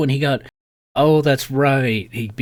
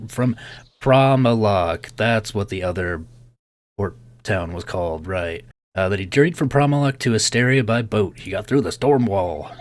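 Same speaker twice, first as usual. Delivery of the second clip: ordinary talk, nothing marked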